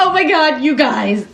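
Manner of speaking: high, loud pretend discovery; mock excited call. Finder of a dead body to friends, mock excited.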